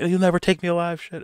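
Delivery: high, loud cornered-villain cry; mock panic. Cornered villain defying the FBI, mock panicked cry. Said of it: "he starts panicking".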